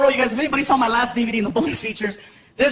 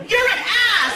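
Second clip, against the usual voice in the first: much higher angry woman; girlfriend voice; 11 semitones higher than usual. Retold or imitated. Girlfriend calling to scold him, high voice.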